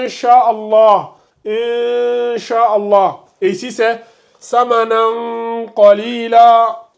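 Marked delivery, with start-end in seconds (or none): 0.0-3.2 "Inch'Allah" very slowly, n hidden in a long nasal hum; drawn-out recited "Inch'Allah", light nasal hum for n; dissimulation with nasalization. Shown as a demonstration. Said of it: "C'est surtout la dissimulation". 4.4-6.9 "samanan qalila" slowly, final n held as a long nasal hum; hidden tanwin. Shown as a demonstration. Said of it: "avec le Qala".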